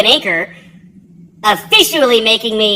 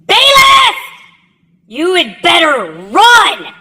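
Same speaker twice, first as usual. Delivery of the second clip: screaming drill instructor; 6 semitones higher, slower than usual. Retold or imitated. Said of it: "and screams".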